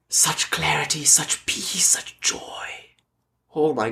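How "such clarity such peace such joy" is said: hushed, breathless awe, softer; soft whispered awe at the dying dog's eyes; awed whisper. Real, not acted. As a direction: whisper it in wonder at what you saw.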